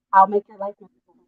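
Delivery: ordinary delivery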